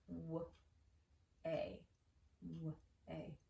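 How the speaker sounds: W and A as separate, soft, isolated sounds; sounding out. Shown as a demonstration. Teacher counting sounds in the last syllable, W and A sounded separately. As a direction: break WAY into W, then A. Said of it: "think of how many sounds".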